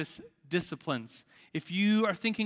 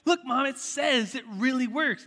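put-on begging kid; 7 semitones higher than usual. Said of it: "I was always begging my mom".

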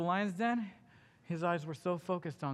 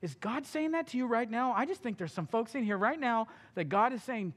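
usual speaking voice

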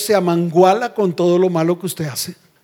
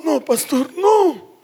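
higher-pitched mock protest; mock protesting congregant; 7 semitones higher, slower than usual. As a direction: imitate a congregant objecting.

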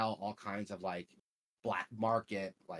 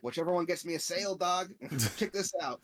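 higher, louder, gleeful salesman voice; happy cyborg vendor; 9 semitones higher, 5 dB louder than usual. Retold or imitated. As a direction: pitch it up like a grinning chromed-out dealer. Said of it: "he's, like, so happy".

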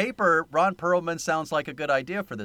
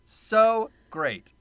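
higher, louder, overdone so great; exaggerated fake praise; mock enthusiasm; 7 semitones higher, 4 dB louder than usual. Real, not acted. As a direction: oversell it with mock delight. Sarcastic reaction to the movie.

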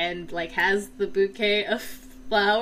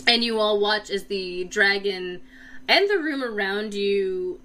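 ordinary talk

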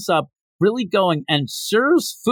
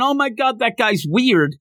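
excited, raised-pitch mock yell; 7 semitones higher than usual. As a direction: imitate shocked onlookers calling out. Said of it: "And they're yelling".

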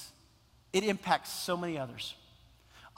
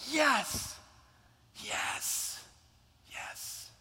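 joyful yes, then whispered yes, yes; exult, then let it dissolve into a breath; rejoicing fading to a whisper; ecstatic yes fading to breath; 7 semitones higher, 4 dB quieter, much slower than usual. Acted. Men rejoicing seeing Jesus.

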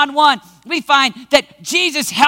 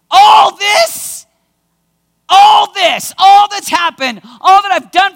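loud, higher indignant shout; snarl; 5 semitones higher, 7 dB louder, slower than usual. Acted. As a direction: bark it back in disbelief. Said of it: "a little bit of a snarl".

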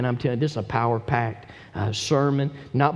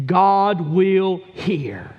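slow, punched GOD WILL HEAR; slow and emphatic scripture quote; emphatic declaration; 5 semitones higher, 6 dB louder, much slower than usual. Acted. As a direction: proclaim each word with weight, drawn out.

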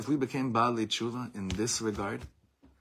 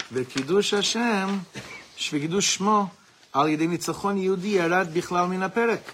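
higher, louder fervent sermon voice; impassioned preaching; 7 semitones higher, 5 dB louder than usual. Acted. Teacher preaching to his class about forgotten war concepts, fervent and raised. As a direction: build into a fiery rebuke from the lectern. Reads Kahane text, preaches on.

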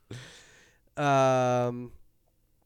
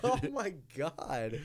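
laughing; 4 semitones higher, 6 dB quieter, slower than usual. Real, not acted. Laughing at fart.

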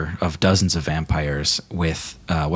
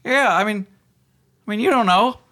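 very high, quick underling voice; high-pitched, hurried comic minion; 14 semitones higher than usual. Acted. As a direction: squeak it up timidly from the back. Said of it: "a low-ranking vampire officer".

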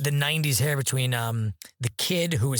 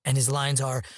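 ordinary talk, nothing marked

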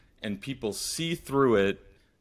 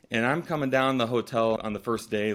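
ordinary talk

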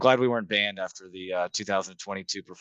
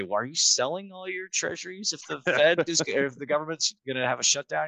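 quoted skeptical investor; 7 semitones higher than usual. Retold or imitated. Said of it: "a lot of people often say".

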